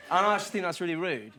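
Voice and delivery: ordinary delivery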